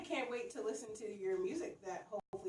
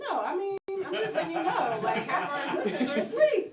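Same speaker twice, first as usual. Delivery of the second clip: talking through laughter, higher and louder; laughing; 6 semitones higher, 9 dB louder than usual. Real, not acted. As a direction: laugh while you talk. Teasing while laughing.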